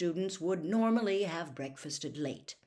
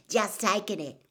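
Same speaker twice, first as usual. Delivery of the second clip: louder, triumphant stage whisper; 2 semitones higher, 6 dB louder than usual. A narrator's reading. Fred telling Harry, Ron and Hermione, triumphant whisper. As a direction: share the secret with triumph. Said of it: "Fred said in a triumphant whisper".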